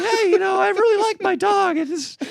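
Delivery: hurt friend, high and near tears; whimpering Nico. Retold or imitated. Imitating Nico defending his dog, high and whimpering. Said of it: "He's like".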